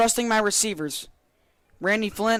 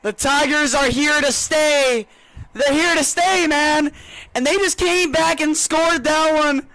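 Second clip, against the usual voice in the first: loud, high celebration; excited commentary; 6 semitones higher, 5 dB louder than usual. Real, not acted. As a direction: shout it with pride. Co-host celebrates the score.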